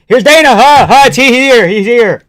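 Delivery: loud, very high-pitched outburst; excited shout. Real, not acted. Streamer spotting Dana on the broadcast, shouting excitedly. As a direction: cry out as the big moment arrives. Announcer appears during live stream.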